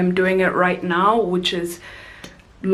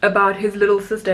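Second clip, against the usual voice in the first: normal talking voice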